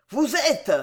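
high, indignant outburst; panting with anger. A narrator's reading. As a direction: cut off in mid-accusation, pitched high. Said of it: "haletant de colère".

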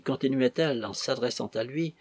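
ordinary delivery

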